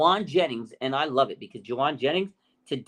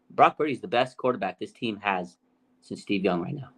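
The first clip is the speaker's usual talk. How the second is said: ordinary delivery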